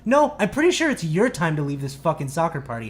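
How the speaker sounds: loud, high, defiant comeback; retold screaming tirade. Teen orders the mom out of the party, yelling. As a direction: throw the order straight back, re-enacted. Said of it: "screaming at the top of my lungs".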